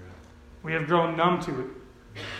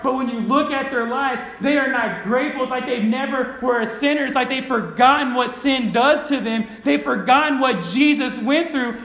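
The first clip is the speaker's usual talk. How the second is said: raised, impassioned preaching; forceful preaching; 5 semitones higher, 4 dB louder than usual. Real, not acted. Preacher voices Jesus' rebuke to Simon, forceful preaching. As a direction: drive it home to the congregation.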